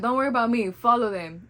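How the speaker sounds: put-on urging mom to follow the baby, loudly; urgent plea. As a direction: insist they go after the baby. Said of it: "I was like".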